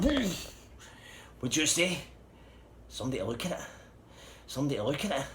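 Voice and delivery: high, incredulous acted repeat; raging. Driver hears his buyer wants the car checked, raging disbelief. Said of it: "he was fucking raging".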